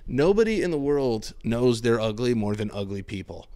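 usual speaking voice

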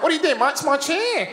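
high-pitched mock Australian complaint; Australian accent. Australian guy claims his chair, exaggerated Australian accent. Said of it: "that's how they sound".

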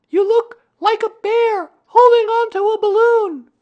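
put-on very high, loud child's shout; child calling up. A narrator's reading. Boy answering the bear overhead, shouting up. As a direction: holler up to the treetop as a boy.

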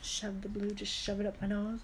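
ordinary talk, nothing marked

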